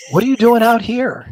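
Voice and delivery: retelling a coworker's shocked question; surprised coworker. Retold or imitated. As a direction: blurt it out like a startled coworker. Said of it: "one of the ops guys goes".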